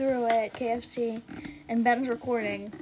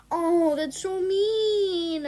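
slow, high drawn-out whine; sympathetic dismay; 6 semitones higher, 4 dB louder, much slower than usual. Real, not acted. Reader feeling sorry for E J, high drawn-out dismay. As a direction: stretch it out, high and pitying. Drawn-out reaction to retold joke.

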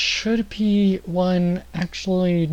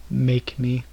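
tough bartender; 5 semitones lower than usual. Acted. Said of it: "The bartender looks at Vlad and he's like".

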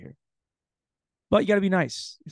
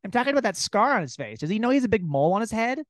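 high, hammed-up musing woman; mock a nosy woman's chatter; higher lightly mocking female voice; 8 semitones higher, faster than usual. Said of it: "They just wake up like".

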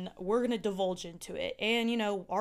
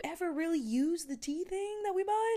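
imagined question to ex; 9 semitones higher than usual. Retold or imitated. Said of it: "I was like".

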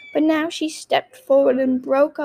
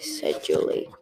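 ordinary delivery, nothing marked

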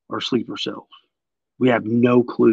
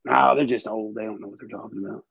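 dismissive mockery; 5 semitones lower, 6 dB quieter, faster than usual. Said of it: "nobody's listening".

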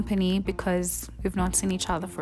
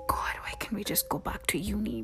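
whispered prayer; 9 dB quieter, faster than usual. Retold or imitated.

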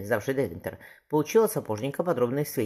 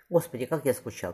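ordinary delivery, nothing marked

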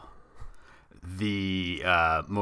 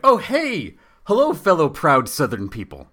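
much higher, louder cheery hello; mock cheery greeting; 8 semitones higher, 8 dB louder than usual. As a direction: greet them with fake enthusiasm.

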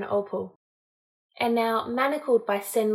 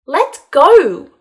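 much louder, high-pitched cry of protest; angry shout; 7 semitones higher, 14 dB louder than usual. A narrator's reading. Woman being dragged out of the creek, angry shout. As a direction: yell it to break free of his grip.

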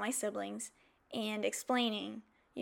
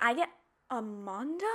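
disbelief. Retold or imitated. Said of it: "And I'm like".

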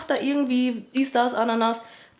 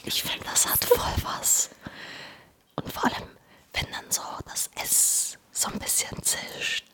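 whispering; 8 dB quieter than usual. Shown as a demonstration. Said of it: "wenn du flüsterst".

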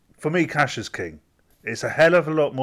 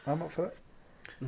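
mock eager; 6 semitones higher, 11 dB quieter, slower than usual. Repeat in odd high voice.

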